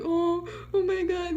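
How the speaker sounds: high, drawn-out mock sympathy; mock concern. Mimicking everybody's fussing reaction.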